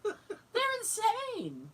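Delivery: high, drawn-out laughing cry; laughing exclamation. Real, not acted. Daughter cracking up about her parents, laughing. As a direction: squeal it through laughter, stretching the words.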